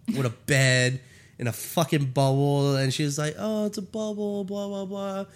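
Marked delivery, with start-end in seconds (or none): 3.4-5.2 higher, softer mock airy recital; a hint of a puzzled woman, pitched up; lightly voiced character. Reviewer retells the woman's reaction, lightly imitated higher voice.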